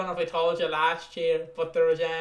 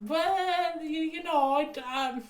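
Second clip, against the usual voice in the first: much higher, hesitant, slowed answer; awkward dad; 9 semitones higher than usual. Retold or imitated. Dad cornered by a kid's Spider-Man question, awkward and high-pitched. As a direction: squirm out an answer he doesn't know. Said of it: "my dad's just sat there like".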